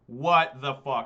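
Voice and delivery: loud, slow disbelieving curse; real exasperation. Real, not acted. Streamer shouting at a bad draw in the league. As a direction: let the frustration out. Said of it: "Deck, I swear to God".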